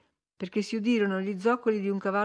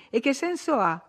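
high-pitched, exasperated question; piqued retort; irritated; 7 semitones higher than usual. A narrator's reading. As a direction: challenge him, voice raised in pitch. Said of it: "ribatté Catherine con irritazione".

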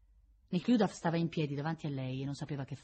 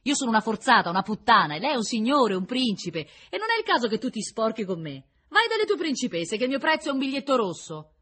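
loud, bitter tirade climbing higher; flushed, hurried outburst; 8 semitones higher, 9 dB louder than usual. A narrator's reading. Prisoner shaming the prince who wronged her, bitterly angry. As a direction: spit out the insults in a rush of anger. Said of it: "cominciò in fretta e si fece di porpora".

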